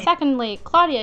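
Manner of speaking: usual speaking voice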